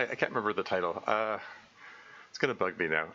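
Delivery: normal talking voice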